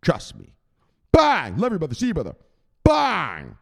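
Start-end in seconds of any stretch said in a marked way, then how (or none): 1.1-3.6 much higher, louder bang; triumphant shout. Real, not acted. Celebrating to chat, loud high triumphant shout. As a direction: punch it out in triumph.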